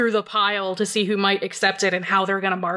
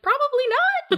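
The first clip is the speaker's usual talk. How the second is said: very high sarcastic "probably not". Real, not acted. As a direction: shoot the idea down way up high. Sarcastic answer to own question.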